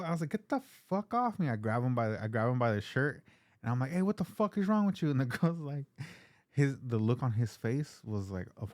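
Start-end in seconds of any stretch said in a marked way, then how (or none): 0.3-1.5 high, forceful get off me; retold yell. Telling the drugged stranger to back off, a high retold yell. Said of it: "I'm yelling at him". 3.9-5.1 high and a bit louder, confronting; retold yell. Grabbing the stranger by the shirt, a high confronting yell. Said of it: "I'm yelling at him".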